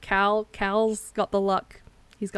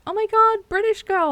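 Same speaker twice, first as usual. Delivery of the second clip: gushing high squeal of a fan; much higher and louder, mock-starstruck; excited onlooker; 13 semitones higher, 6 dB louder than usual. People mistaking her for British, imitated as a much higher, louder gush. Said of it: "people being like".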